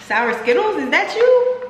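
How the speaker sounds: high squeal of surprise; delighted shock at the taste; surprised. Real, not acted.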